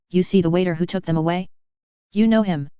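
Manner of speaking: ordinary delivery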